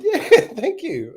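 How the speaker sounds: high, slow, laughing reply; chuckling thanks to the plasterer for the shirt compliment. Retold or imitated. Laughing high and slow.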